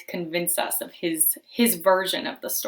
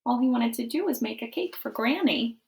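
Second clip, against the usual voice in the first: usual speaking voice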